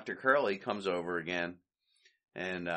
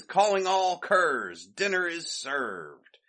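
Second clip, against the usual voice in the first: mock announcement; 6 semitones higher, slower than usual. Curly announcing on the microphone.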